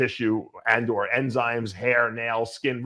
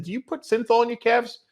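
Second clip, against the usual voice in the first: high-pitched needling question; teasing; 11 semitones higher than usual. Retold or imitated. Bodybuilder ribbing a rival about his calves, teasing. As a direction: rib him in a raised, mock-innocent pitch. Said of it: "I broke his balls".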